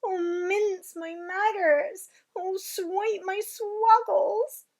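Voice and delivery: high, loud, slow giant lament; drawn-out anguished giant cry. Acted. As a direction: wail in despair. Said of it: "he cried".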